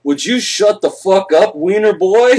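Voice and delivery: deadpan teacher retort, pushed up and loud; teacher snapping back. Retold or imitated. Teacher looking over his glasses at the heckler, loud put-down. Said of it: "and he goes".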